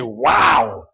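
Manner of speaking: loud, full-hearted exclamation; passionate wow. Acted. Preacher showing how a rugby crowd would react, passionately. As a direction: let it burst out with feeling. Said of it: "full of that kind of deep emotion and passion".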